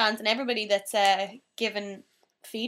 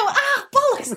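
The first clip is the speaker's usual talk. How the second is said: laughing; 6 dB louder, slower than usual. Real, not acted. Losing jinx.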